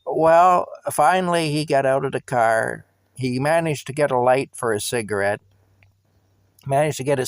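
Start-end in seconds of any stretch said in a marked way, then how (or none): none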